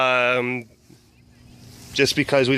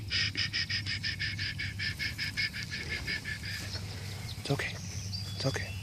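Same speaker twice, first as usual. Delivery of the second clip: soothing a scared dog. Real, not acted. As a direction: hush a frightened pet gently.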